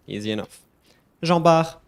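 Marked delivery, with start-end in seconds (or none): 1.2-1.8 "Jean Bart" loud as "zhahn BAR", silent t; French pronunciation. Shown as a demonstration. Teacher introducing the name, loud French "Jean Bart". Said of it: "you don't say the T".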